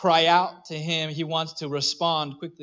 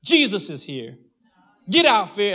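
louder, higher "Get out, fear" rebuke; bold command; 5 semitones higher, 6 dB louder than usual. Shown as a demonstration. Father teaching his son to rebuke fear, bold shout. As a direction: order fear away like a brave kid. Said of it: "we taught him this saying".

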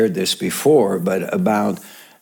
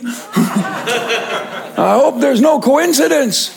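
laughing punchline; 13 semitones higher, slower than usual. Retold or imitated.

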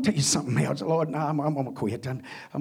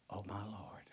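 whisper; 5 semitones lower, 17 dB quieter than usual. Retold or imitated. Shocked at tailored suits.

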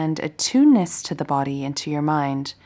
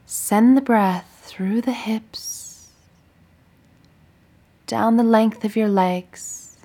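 guided meditation. Acted. Teacher guiding listeners lying down through a body scan, meditative. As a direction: guide them gently through breath and body, unhurried.

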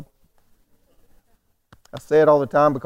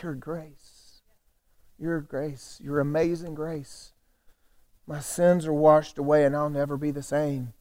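hushed worship; 6 dB quieter, slower than usual. Acted. Preacher voicing the song's quiet turn to God, hushed worship. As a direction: stand still and breathe it out softly, slowly. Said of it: "whole tone of the song changed".

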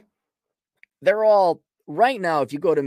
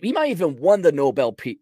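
ordinary delivery

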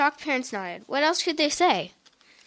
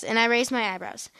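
plain reading voice

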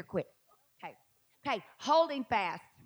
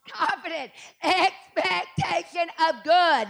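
higher, louder, slow preaching build; fiery preaching; 5 semitones higher, 4 dB louder, slower than usual. Real, not acted. Preacher rousing the congregation, high and loud. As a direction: preach it with fire.

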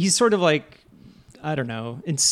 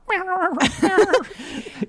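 mock dog laugh. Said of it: "the Jack Russell's just like".

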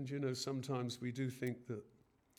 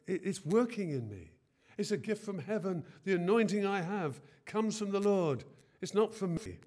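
rising, louder sermon voice voicing Paul; preaching at full force; 6 semitones higher, 7 dB louder than usual. Preacher voicing Paul's claim to the congregation, full-force preaching. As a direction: proclaim it from the pulpit.

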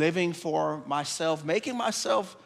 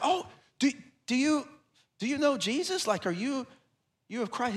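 much higher, excited pitch; eager question; 7 semitones higher than usual. Retold or imitated. Shane eagerly asking the waiter about Jesus, excited and high. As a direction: pop up high, bursting to ask.

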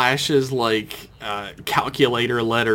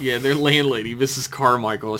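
usual speaking voice

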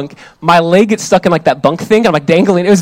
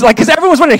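ordinary talk, nothing marked

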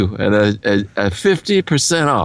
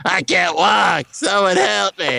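a slow, higher-pitched little plea from a hot dog; helpless hot dog; 6 semitones higher than usual. Acted. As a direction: play the hot dog begging for help, slowly.

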